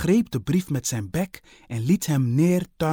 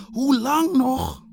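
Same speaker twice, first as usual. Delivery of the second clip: high, drawn-out cry; high impatient call; 13 semitones higher, 5 dB louder, slower than usual. Acted. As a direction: call out impatiently, much higher. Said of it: "riep Marie uit".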